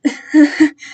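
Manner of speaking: short nervous laugh; awkward uneasy chuckle; uncomfortable laugh. A narrator's reading. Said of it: "then laughed uncomfortably".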